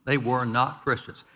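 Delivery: slow, with NOT leaned on hard; stress on NOT. Shown as a demonstration. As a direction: press down on the word not, slowly. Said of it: "Notice the emphasis on the word "not".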